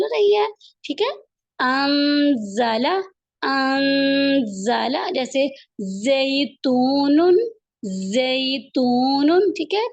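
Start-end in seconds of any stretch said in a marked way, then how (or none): 1.6-5.0 انزل said very slowly with a hissing ز; repeat-after-me model. Shown as a demonstration. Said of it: "آپ بھی پیچھے پیچھے پڑھیں میرے". 6.0-9.4 انزل said very slowly with a hissing ز; repeat-after-me model. Shown as a demonstration. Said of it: "آپ بھی پیچھے پیچھے پڑھیں میرے".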